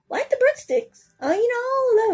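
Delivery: high, gushing delight. Real, not acted. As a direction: gush over the treat. Gushing about breadsticks.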